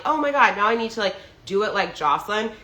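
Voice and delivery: normal talking voice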